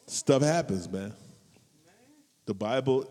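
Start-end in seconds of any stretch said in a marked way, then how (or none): none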